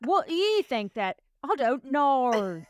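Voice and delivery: high, loud, hammed-up Aussie accent; Australian tour guide impression. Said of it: "I'm trying it".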